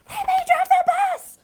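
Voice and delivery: very high, loud screamed demand; tantrum scream. Retold or imitated. Pigeon screaming its tantrum again at the reader. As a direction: scream like a kid melting down. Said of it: "what we call having a fire".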